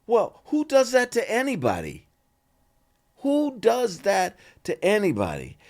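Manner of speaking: can you believe it, pitched high; indignant disbelief. Real, not acted. Telling the host about a rude guest star, indignant and high.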